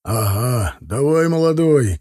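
mild farewell shout, rising in pitch; lightly acted trucker's holler; calling out. A narrator's reading. Sergei sees the young driver off, calling out. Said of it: "крикнул Сергей".

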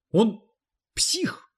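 the word псих flung out high; outraged outburst. A narrator's reading. Cleaning lady declares the author insane, shocked. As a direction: blurt it out in shock.

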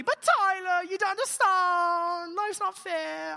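high, drawn-out mock British whine; hammed-up British complainer, wailing; whiny British voice. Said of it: "I hear it in a British voice".

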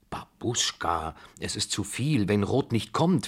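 ordinary delivery

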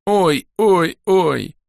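high, muffled little yelps; strangled cries. A narrator's reading. Creature hit by falling rock in a pit, strangled yelps. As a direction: squeeze out three short high "ow"s. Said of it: "из ямы сдавленно послышалось дробное".

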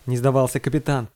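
plain reading voice

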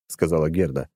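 plain reading voice

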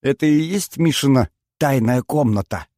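usual speaking voice

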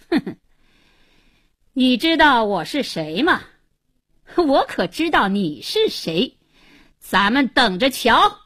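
blustering, vengeful villain; sudden menace. A narrator's reading. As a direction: turn on them with a scornful huff and threaten. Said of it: "突然他脸色一变".

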